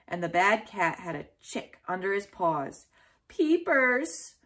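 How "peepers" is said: high alarmed cry of a name; calling out. A narrator's reading. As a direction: cry out to save the chick. Boy sees cat with chick.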